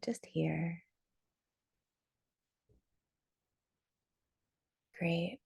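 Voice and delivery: unhurried meditation guide, long pauses between phrases; guided meditation. Real, not acted. Teacher leading arrivals on Zoom into a short drop-in, guided meditation. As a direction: settle them gently into the body, leaving space.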